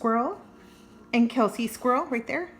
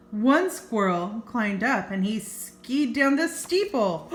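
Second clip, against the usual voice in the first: lively read-aloud storytelling; story narration. A narrator's reading. Reading a squirrel picture book to kids, storytelling voice.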